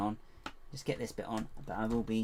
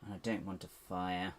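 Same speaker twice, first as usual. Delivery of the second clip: ordinary delivery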